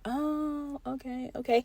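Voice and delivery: quiet realization. Retold or imitated. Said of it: "And I was like".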